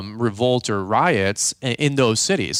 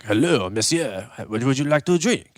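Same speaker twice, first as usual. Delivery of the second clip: put-on French waiter accent. French waiter asking a diner for drinks, mock French accent. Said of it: "French waiter guy".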